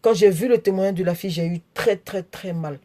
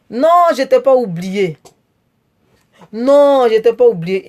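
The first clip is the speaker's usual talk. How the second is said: loud protest; 2 semitones higher, 6 dB louder than usual. Real, not acted. Streamer loudly protesting to a viewer in the chat. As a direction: cry out that you never forgot him.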